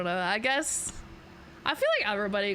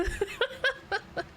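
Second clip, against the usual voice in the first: very high falling giggle; 14 semitones higher, 3 dB quieter than usual. Real, not acted. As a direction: giggle at yourself. Laughs about bad accents.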